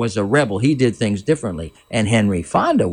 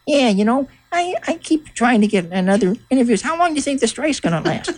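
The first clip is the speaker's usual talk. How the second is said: high put-on voice, much higher than his own; little people voices; 13 semitones higher than usual. Little people actors griping about the strike, in a high put-on voice. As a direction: mimic out-of-work actors complaining in a pitched-up voice. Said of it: "talking in their little people voices".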